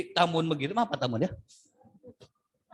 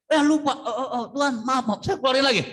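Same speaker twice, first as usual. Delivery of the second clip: high, startled, hurried apology to God; suddenly remember you forgot to pray; mock startled prayer; 6 semitones higher than usual. Acted startled prayer while eating. (doa kaget pura-pura, nada tinggi)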